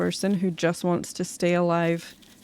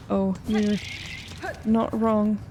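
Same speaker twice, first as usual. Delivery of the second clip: ordinary talk, nothing marked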